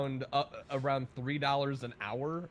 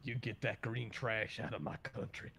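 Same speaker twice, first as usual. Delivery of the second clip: soft, lower put-on grumble; 4 semitones lower, 7 dB quieter than usual. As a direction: grumble like an outraged patriot in jest. Mock anti-marijuana patriot rant.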